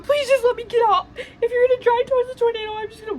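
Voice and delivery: high, loud, rushed begging; hammed-up tearful panic, pitched high; retold crying plea. Begging to be let out of the car, mock crying. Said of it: "like, crying at this point".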